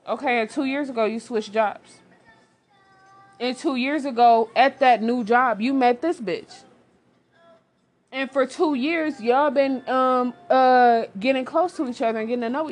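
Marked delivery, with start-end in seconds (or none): 3.4-11.0 heated callout. Real, not acted. Calling out the cheating husband.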